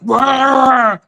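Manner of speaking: drawn-out mock monster roar; sea creature roar. Speaker acting out the scary sea creature, roaring. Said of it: "look like terrifying sea creature".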